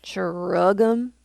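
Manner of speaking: lightly voiced gruff old frog; a hint of deep, gruff croak. A narrator's reading. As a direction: grunt it like Grandfather Frog. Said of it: "in his deepest, grum-, gruffest voice".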